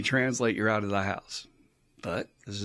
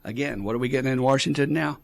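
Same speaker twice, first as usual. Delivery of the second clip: normal talking voice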